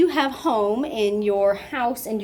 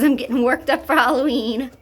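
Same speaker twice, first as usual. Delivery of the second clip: lightly voiced cat, a bit higher; voicing the kitten; 4 semitones higher than usual. Owner speaking for the worked-up kitten in a light put-on voice. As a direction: give the kitten a playful little voice. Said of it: "She says".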